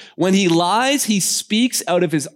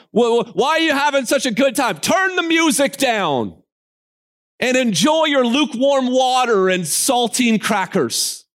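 a higher, scolding killjoy God voice; mock stingy God; 7 semitones higher than usual. Preacher mocks a killjoy God to the congregation, higher and scolding. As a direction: nag like a spoilsport deity.

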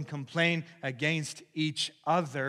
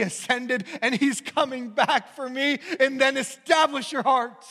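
preaching at full force; 9 semitones higher, 11 dB louder than usual. Real, not acted. Preacher building to a peak before the congregation, loud and high. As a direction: drive the congregation to resolve, building.

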